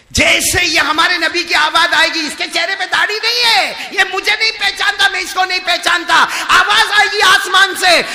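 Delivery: high, loud shouted judgement; thundering preaching. Acted. Preacher shouting the voice from heaven at the sinner. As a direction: thunder it at the crowd.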